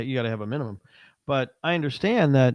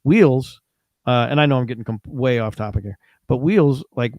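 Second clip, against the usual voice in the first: ordinary delivery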